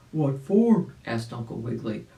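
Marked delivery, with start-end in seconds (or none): 0.1-0.9 put-on louder puzzled old rabbit; rabbit asking. Uncle Wiggily asking Arabella why, louder. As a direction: ask it plainly curious.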